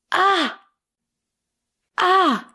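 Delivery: a sharp high cry of surprise; surprised cry. Real, not acted. Reacting to a quiz answer with a high startled ah.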